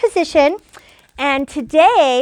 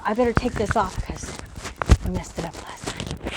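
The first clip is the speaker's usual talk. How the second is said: quiet aside; 6 semitones lower, 9 dB quieter, faster than usual. Real, not acted. Aside to herself, dropped voice.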